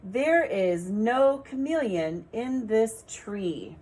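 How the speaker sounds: slow, clear teacher reading; slow sentence reading for children; slow read aloud. Shown as a demonstration. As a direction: read it slowly for beginners. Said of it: "see where the sight words are".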